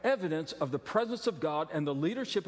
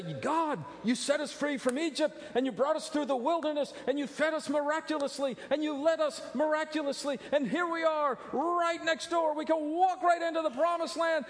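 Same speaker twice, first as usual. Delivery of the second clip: raised, high-pitched proclaiming; people crying out; 8 semitones higher than usual. Retold or imitated. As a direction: call out the wonders to God at full voice. Israelites voiced pleading to God.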